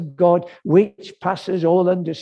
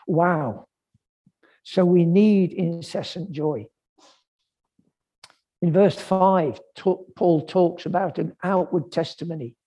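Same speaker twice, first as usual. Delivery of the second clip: ordinary talk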